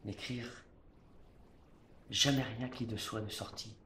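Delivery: hushed declamation. Acted. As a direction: bring it down to a proud hush. Proud, inward close of tirade.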